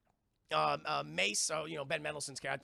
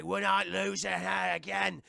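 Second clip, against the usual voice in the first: mock henchman; 5 dB louder, slower than usual. Henchman voiced in recap.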